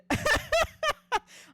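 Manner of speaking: squealing laugh; high laugh. Real, not acted. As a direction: bursts into giggles. Laughs at own teasing.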